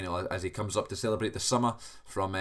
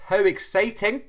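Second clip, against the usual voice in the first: excited; 12 semitones higher, 7 dB louder than usual. Pretend. Mock-excited podcast welcome.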